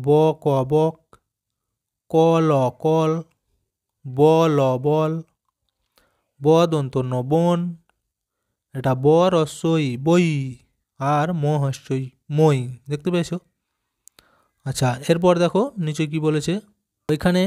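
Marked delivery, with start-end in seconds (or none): 0.1-3.1 put-on slow letter-by-letter blending for children; slow spelling demo. Shown as a demonstration. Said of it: "আমি উচ্চারণগুলি বলে দিই". 4.1-5.1 ব and ল drawn out, then বল slowly; very slow spelling demo. Shown as a demonstration. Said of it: "আমি উচ্চারণগুলি বলে দিই". 6.4-12.6 each letter spaced, then the word slowly; careful letter-by-letter blending; slow spelling demo. Shown as a demonstration. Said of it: "আমি উচ্চারণগুলি বলে দিই".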